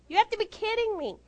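high-pitched incredulous outburst; retold exasperation. Retold disbelief, escalated repeat.

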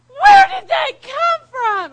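slow, high, full-blast shout; outraged, alarmed holler; shocked yell. Retold or imitated. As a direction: scream the question, stretching each word. Driver shocked by speeding car.

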